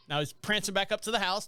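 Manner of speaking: ordinary talk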